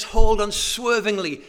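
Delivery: full-force preached command; preached shout. Acted. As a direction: proclaim it to the back of the hall. Rising sermon crescendo.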